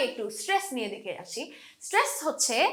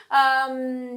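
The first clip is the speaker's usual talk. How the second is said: usual speaking voice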